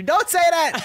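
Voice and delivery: high and loud, laughing; mock child protest. Child begging her father to stop, loud and laughing. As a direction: squeal it like a kid who hates the word. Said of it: "He's like".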